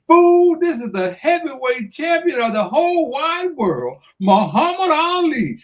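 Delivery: high, loud boast on the phone; the champ shouting down the phone at him. Retold or imitated. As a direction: proclaim it like the champ. Ali announces himself, bragging.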